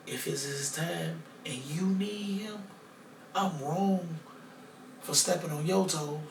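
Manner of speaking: soft retold prayer for his dad; quiet prayer. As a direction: pray softly to God. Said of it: "I'm talking to God now".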